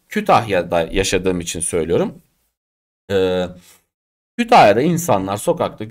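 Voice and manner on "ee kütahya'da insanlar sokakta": ordinary talk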